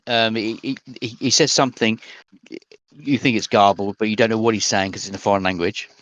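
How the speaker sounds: ordinary talk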